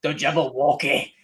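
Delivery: low, dark Irish brogue on the Jabberwocky; Irish Depp impression. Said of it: "he has to go Irish".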